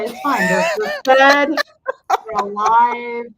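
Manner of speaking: very high, strained singsong, drawn out; mock self-reassurance. Mother bracing herself on the way home, mock-hysterical reassurance. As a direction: talk yourself down in a squeaky, half-laughing wail.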